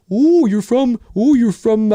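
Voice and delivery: high, hammed-up Canadian border guard; cartoon Canadian accent. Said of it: "Nobody talks like that".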